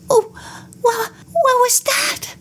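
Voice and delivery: high, startled stammer with a catch on wha-; frightened chattering. A narrator's reading. Sadie startled by a yell at night, high frightened stammer. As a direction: stutter it out as if a yell just made you jump. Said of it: "Sadie chattered".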